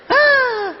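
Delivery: high, loud drawn-out "ah"; exasperated wail. Acted. End of a complaint to God, exasperated wail. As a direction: wail it out in mock despair. Exasperated cry ending the acted prayer.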